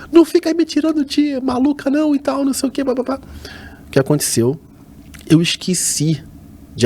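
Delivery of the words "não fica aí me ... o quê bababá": high, annoyed mock-mother voice; mother's high scolding voice. Mother scolding her son on the phone, high and annoyed. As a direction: play the irritated mom, pitched up.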